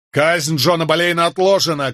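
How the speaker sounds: high urgent shout. A narrator's reading. The lawyer shouts to the soldier that the execution is postponed. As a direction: call out over the crowd. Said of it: "крикнул я".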